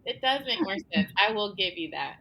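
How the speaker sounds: ordinary delivery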